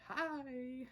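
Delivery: a high, bright-pitched little greeting; chirpy hi. Retold or imitated. Luce greeting the club after moonwalking in, high cheeky hi. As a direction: pop in with a cheeky high hello. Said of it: "and she's like".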